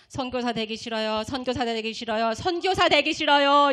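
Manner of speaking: repeated chant building to loud; pastor interpreting tongues. Retold or imitated. Pastor interprets the student's tongues, building to shouting. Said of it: "뭐라고 하시냐면".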